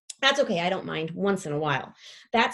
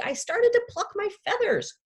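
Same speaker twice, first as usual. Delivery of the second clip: plain reading voice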